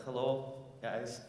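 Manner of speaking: ordinary talk, nothing marked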